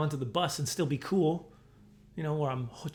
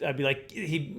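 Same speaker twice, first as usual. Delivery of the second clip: ordinary talk, nothing marked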